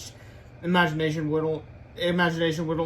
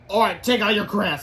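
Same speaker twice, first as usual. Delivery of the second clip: loud, pitched-up teacher's order; stern raised classroom voice; harshly; 4 semitones higher, 6 dB louder than usual. A narrator's reading. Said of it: "said Miss Ribble harshly".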